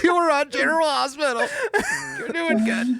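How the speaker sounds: laughing mock praise. Joining the mock affirmation.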